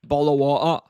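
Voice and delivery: loud British accent, punching the phrase out. Shown as a demonstration. Streamer saying a test phrase for chat, British accent. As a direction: show off a British accent loudly. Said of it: "with British accent".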